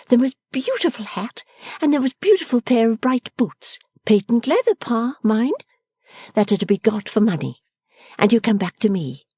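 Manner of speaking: a lively daughter giving orders fondly; bright young daughter. A narrator's reading. Bella giving orders to father.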